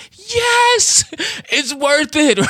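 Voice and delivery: high, loud delighted yes; inner triumph. Retold or imitated. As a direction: cheer silently that it paid off. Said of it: "I'm like".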